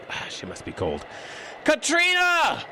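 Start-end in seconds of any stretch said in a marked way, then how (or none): 1.6-2.6 high drawn-out shout into the snow; a slow, long call across the dark. Acted. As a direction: stretch her name out slowly, yelling. Searching in the blizzard.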